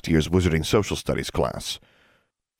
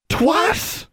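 very high incredulous question; high, rising shocked echo; 3 dB louder than usual. A narrator's reading. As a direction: squeal it back in disbelief. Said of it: "Constance and Forbearance said together".

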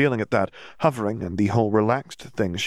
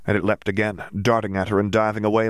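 ordinary delivery, nothing marked

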